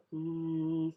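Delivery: high, soft hummed note. Retold or imitated. Fan copying Paul's soft guide note, hummed. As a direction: hum one gentle guiding note. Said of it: "'cause he's humming".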